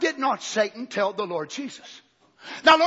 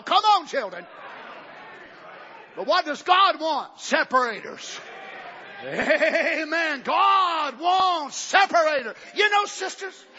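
loud, higher shouted preaching; full-force preaching; 8 semitones higher, 4 dB louder, slower than usual. Acted. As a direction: drive it home to the congregation.

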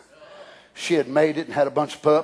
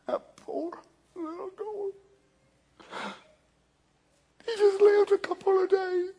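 much higher, softer, mock-pitiful whispered lament; pitiful whimper; 13 semitones higher, 10 dB quieter than usual. Preacher mocks Jonah grieving the gourd, high breathy whisper. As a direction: whimper over the dead gourd in mock pity.

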